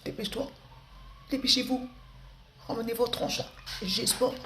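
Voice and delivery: put-on high, impatient teen sass; rude teen voice. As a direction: play a cheeky ado ordering his parents around. Comedian acts a rude teenager.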